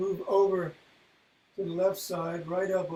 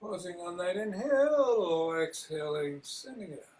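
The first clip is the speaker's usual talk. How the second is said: guided meditation; 5 dB quieter than usual. Acted. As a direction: guide the breathing gently and quietly. Leads a breathing meditation.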